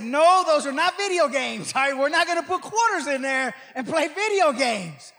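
loud, emphatic mock-parental correction; emphatic refusal. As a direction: put your foot down with a child, playing it up. Retold correction of excited grandchild.

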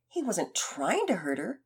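plain reading voice

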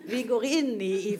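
words spilling out through a laugh; amused, half-laughing; laughing while talking. Real, not acted. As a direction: keep the laugh going as you start the sentence. Still laughing after the laugh.

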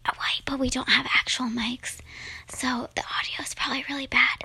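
breathy ASMR whisper. Shown as a demonstration. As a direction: keep it all in a whisper. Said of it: "you're supposed to whisper".

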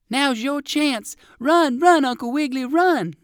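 high, loud urgent shouting; calling out. A narrator's reading. Poodle doggy urges the rabbit to escape, calling out. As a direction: urge him on at high pitch. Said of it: "called Fido Flip Flop".

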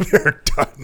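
breathy laughing echo; mock it while laughing; laughing whisper.

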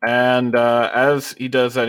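ordinary delivery

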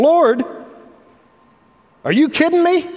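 high, loud incredulous outburst as Peter. Retold or imitated. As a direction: Peter cannot believe the question. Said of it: "Simon Peter had answered him".

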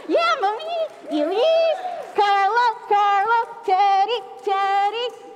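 loud, high calls across the room; calling out names. Real, not acted. Pastor calling children up onstage, loud and high.

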